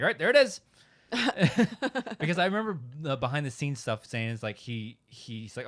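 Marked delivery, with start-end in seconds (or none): 0.0-0.6 higher, louder offhand mock; mock Lucas, careless. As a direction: toss it off like it's done.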